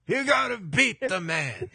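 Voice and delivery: high, slow swaggering wrestler; Ric Flair impression. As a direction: strut the line like Flair. Continuing Flair catchphrase.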